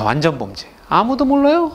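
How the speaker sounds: ordinary delivery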